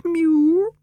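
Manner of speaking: very high, level, thin-pitched mew; high-pitched answering meow, held level; echoing cat's mew. A narrator's reading. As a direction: mew back in a high female cat voice. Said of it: "and Florence echoed".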